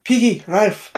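put-on lightly doing a thick Irish accent. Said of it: "in Mister Burke's thick Irish accent".

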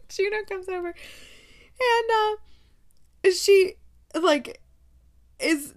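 high-pitched, giggly narration; words squeezed out high through a laugh; laughing while talking. Real, not acted. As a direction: try to carry on through real laughter. Reader cracks up while retelling.

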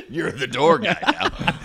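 said through laughter, high and slow; repeating the joke while cracking up; laughing. Real, not acted. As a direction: laugh the words out.

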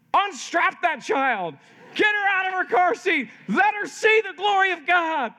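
put-on high, impassioned cry to free the child; acted urgent command. Father urgently orders child freed.

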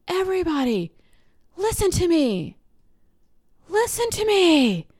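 high-pitched demand for the room's attention; little boy calling out. Retold or imitated. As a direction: imitate a child addressing a whole crowd. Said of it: "he's like".

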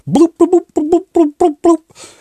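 high-pitched rhythmic bloops; imitated Skype ringing. Podcaster imitates incoming Skype call, high blooping ringtone. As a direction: mimic a computer call tone in a high voice. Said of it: "The Skype button comes up".

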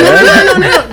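laughing protest. Real, not acted. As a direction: say no through laughter.

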